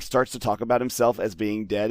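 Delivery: ordinary talk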